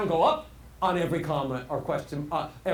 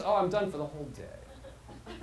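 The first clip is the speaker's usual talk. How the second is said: fading out; 4 semitones lower, 6 dB quieter than usual. Shown as a demonstration. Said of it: "when I'm done with the sentence".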